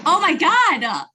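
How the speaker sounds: high, drawn-out shocked exclamation; alarmed. Real, not acted. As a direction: gasp it out slowly, high with alarm. Reacting to friend's sudden cramp.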